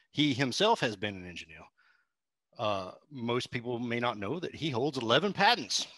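courtly put-on Southern gentleman; genteel, hammed-up Southern accent. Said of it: "homebrew walkthrough in that accent".

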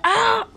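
high, short "ah" yelp; pretend scream. Character getting run over, high pretend scream. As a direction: yelp as if hit.

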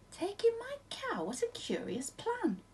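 put-on high, slow, doubting old lady; puzzled old lady. A narrator's reading. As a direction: squeak it out in disbelief, slowly.

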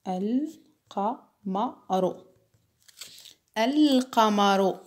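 القمر slowly, the lam of ال clearly sounded; lam pronounced slowly. Shown as a demonstration. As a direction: stretch القمر and let the lam be heard. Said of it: "اللام هنا تنطق". (نطق اللام في القمر ببطء)

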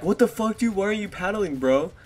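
high-pitched imitation of a teasing friend; mock complaint. Teasing friend's feigned complaint, retold.

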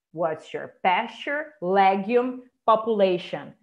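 slow model, PASTURE, LEGUME, POPULATION each set apart; teacher's slow drill, pausing between pasture, legume and population; stressed syllables marked. Shown as a demonstration. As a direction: stress PAS-ture, LEG-ume, popu-LA-tion. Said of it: "as estressadinhas tá sublinhado".

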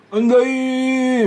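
high, very slow drawn-out call; rough old man's greeting. Retold or imitated. Imitating the old man greeting the barber. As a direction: drag the greeting out, brash and loud. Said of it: "みたいな感じですよ".

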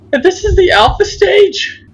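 can't believe it's only the alpha; shrill-edged disbelief; incredulous squeak. Real, not acted. Disbelief after a scare.

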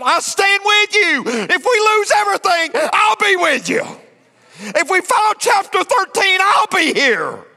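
fervent pulpit shout of devotion; preaching shout. Acted. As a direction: belt the vow out. Preacher voicing loyal wife.

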